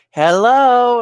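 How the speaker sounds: hello called out high and loud. Retold or imitated. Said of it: "I definitely hear this".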